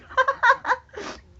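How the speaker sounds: high shrieking burst of laughter. Real, not acted. Laughing at himself to the chat, shrieking. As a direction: crack up at your own line.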